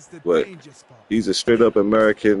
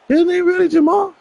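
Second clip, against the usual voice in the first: very high imitated incredulous voice; mock disbelief. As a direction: squeak out a mock-surprised question. Voices surprised people hearing his name.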